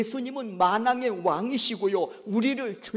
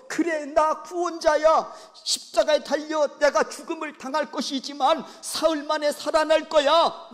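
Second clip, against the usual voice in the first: Jesus revealing; 7 semitones higher, 6 dB louder than usual. Acted. Jesus telling Peter he will die and rise, solemn. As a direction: announce the secret like a solemn teacher.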